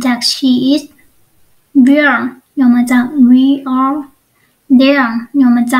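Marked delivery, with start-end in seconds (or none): none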